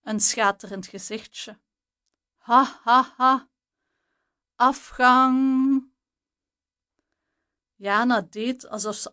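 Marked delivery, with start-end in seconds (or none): 2.4-5.9 loud, jeering ha ha ha then a scornful afgang; mocking laugh. A narrator's reading. Jana mocking the narrator's message with a jeering laugh. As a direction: sneer it out like a schoolyard bully. Mocking typed reply read out.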